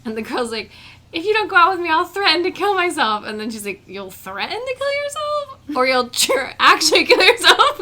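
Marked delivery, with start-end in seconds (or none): 1.1-3.2 put-on loud, high-pitched girlfriend ultimatum; mock dramatic threat. Imitating the girl from Shameless, mock dramatic threat. 3.9-7.8 high, disbelieving query that gets louder; mock incredulous question. Imitating the woman answering the Shameless girl, mock incredulity.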